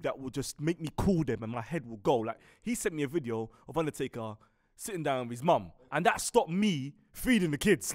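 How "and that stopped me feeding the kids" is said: ordinary talk, nothing marked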